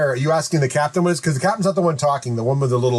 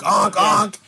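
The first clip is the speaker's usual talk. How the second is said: goose honks, pitched up; mock goose honk; 4 semitones higher than usual. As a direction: imitate a honking goose. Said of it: "It's like".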